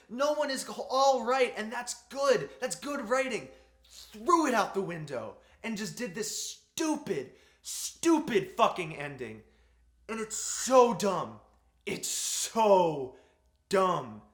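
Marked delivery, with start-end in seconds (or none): 4.1-14.2 frustrated rant. Real, not acted. Ranting at the film's ending.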